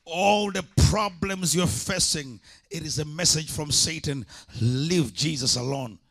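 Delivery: loud, rising call to the congregation; full-force preaching. Acted. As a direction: preach it out, full voice, stirring the crowd.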